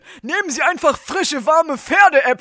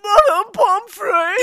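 slow, higher whine; whimpering plea; 5 semitones higher, slower than usual. Acted. Sick boy whining for the nurse, slow and higher. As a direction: drag yourself in calling for help.

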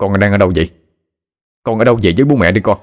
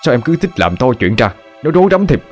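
irritated snapping; 2 semitones higher than usual. Acted. Husband scolding his crying wife, irritated snap. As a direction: tell her off for making a fuss. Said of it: "Ông gắt lên".

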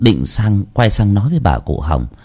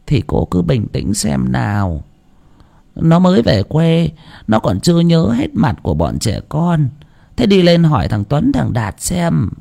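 calm the crying neighbour; gently reassuring grandmother; calming grandma; 6 semitones higher than usual. A narrator's reading.

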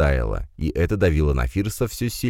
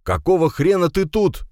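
high, raised indignant demand; irritated outburst; 13 semitones higher, 4 dB louder than usual. A narrator's reading. Firs confronts uninvited guest at the door, indignant.

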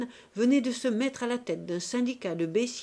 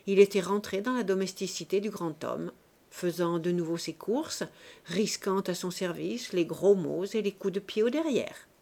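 ordinary delivery, nothing marked

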